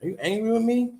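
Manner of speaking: high, anxious pleading question; hammed-up fretful boyfriend voice; mock worried asking. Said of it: "And I'm like".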